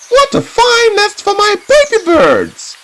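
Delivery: very high chirpy bird voice. Acted. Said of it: "said the bird".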